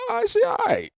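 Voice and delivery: very high-pitched mock concession; grudging approval, imitated. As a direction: squeak out an acted-out approval. Voices his past verdict on sister.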